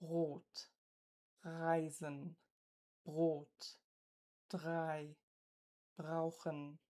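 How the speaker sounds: Rot, Reisen, Brot, drei, brauchen with a throaty rasped R; R in Rot, Reisen, Brot, drei, brauchen scraped at the uvula; uvular fricative r. Shown as a demonstration. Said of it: "examples using the uvular fricative".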